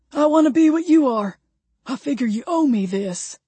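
high-pitched, pleading character voice. A narrator's reading. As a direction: make a heartfelt case to join them.

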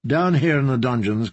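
ordinary delivery, nothing marked

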